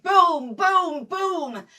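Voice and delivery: three loud, high booms; imitated gun booms. Nurse imitating the guns day and night, loud booming. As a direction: boom it out like shellfire.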